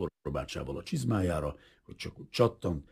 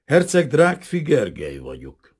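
measured formality; 8 semitones higher, 9 dB louder than usual. A narrator's reading. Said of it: "úgy mondta kimérten".

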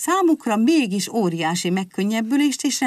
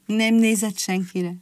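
ordinary delivery

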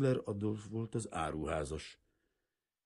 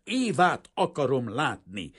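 angry shout; 8 semitones higher, 10 dB louder than usual. A narrator's reading. Kazimír shouting, grabbing him.

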